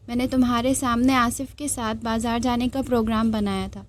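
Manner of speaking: plain reading voice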